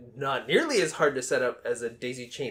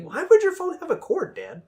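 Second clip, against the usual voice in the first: put-on kid asking dad; 5 dB louder than usual. Voicing future kid asking dad.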